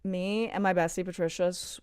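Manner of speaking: ordinary talk, nothing marked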